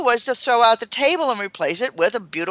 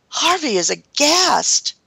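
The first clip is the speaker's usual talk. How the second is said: ordinary talk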